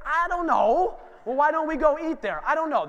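high, loud, exaggerated clueless shrug; mock puzzled dad. Dad answering kids' car questions.

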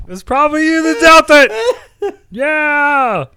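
laughing so hard it cracks. Real, not acted. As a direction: accuse while cracking up. Laughing accusation.

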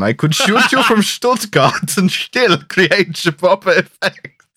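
laughing, hammy German hitman; German accent. Pretend. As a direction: overdo the German villain line. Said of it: "parody of the line".

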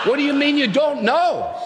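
high-pitched incredulous question; baffled. Acted. Angel questions thief at heaven's gate, baffled. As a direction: demand it in high disbelief.

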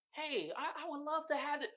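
hammed-up soft polite applicant; timid applicant. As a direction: play a meek eager job seeker. Mock eager job applicant.

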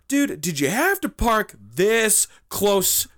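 high, drawn-out, incredulous complaint; indignant confrontation. Retold or imitated. Confronting driver who parked too close, indignant. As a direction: stretch the words out in mock outrage.

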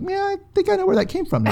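quick high-pitched joking aside; high, hurried mock musing; wry mock realization. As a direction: toss it off fast in a squeaky comic voice. Joking voice for knowing reaction.

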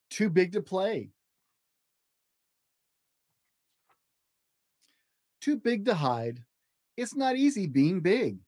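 slow, lilting picture-book read with long page-turn pauses; reading to children. Acted. Dad reading a picture book to his child, slow storybook lilt. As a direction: read it like a bedtime storybook for a little one.

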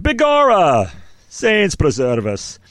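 hammed-up Irish brogue exclamation, high then slow; a stage Irishman crying out, slowed at the end; mock Irish accent. Host opening the Ireland episode in a mock Irish accent. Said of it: "I knew you were gonna do some sort of accent".

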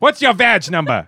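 loud, slow demand through laughter; mock cop-style demand for a badge number; laughing mock demand. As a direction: bark it while cracking up.

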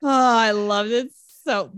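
ordinary talk, nothing marked

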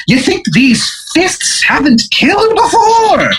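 high, slow, bad Irish brogue; Izzy Irish. Old-timey boxer showing off his stance, high bad Irish accent. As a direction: boast about your fists in a hammy Irish accent. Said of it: "his accent is horrendous".

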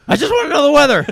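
high, loud exasperated wail; mock desperate yell. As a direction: plead at the top of your voice. Acting out an overwhelmed news viewer.

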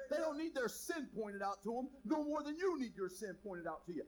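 loud, driving sermon voice; full-force preacher; preaching. Acted. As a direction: preach it out to the crowd.

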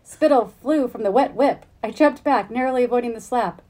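expressive read-aloud of a novel passage; story reading. Acted. The host reads the novel's scene to its author, an animated reading.

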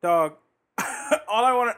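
ordinary delivery

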